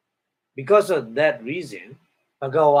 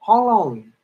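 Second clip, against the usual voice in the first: startled question; 7 semitones higher, 7 dB louder than usual. Retold or imitated. Celestial questions reborn friend.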